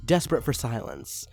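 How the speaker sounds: plain reading voice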